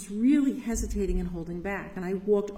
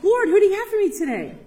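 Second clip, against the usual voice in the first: higher, louder and faster, full of eagerness; an eager, louder plea to the Lord; eager, fervent prayer; 9 semitones higher, 6 dB louder, faster than usual. Shown as a demonstration. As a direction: pray it like you can't wait to be sent. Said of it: "That's what we should be like".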